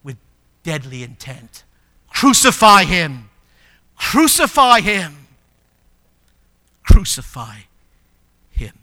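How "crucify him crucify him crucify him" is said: loud, slow, furious crowd chant; crowd's angry cry. Retold or imitated. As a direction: bellow it like a mob demanding death. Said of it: "the city was crying and screaming out".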